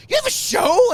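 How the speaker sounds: very high, shouted question; going crazy. Retold or imitated. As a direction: shout it in amazed excitement. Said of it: "he was, like, going crazy".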